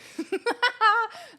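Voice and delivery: loud, high burst of laughter. Real, not acted. Laughs at the alert clip.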